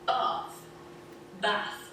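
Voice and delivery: ordinary delivery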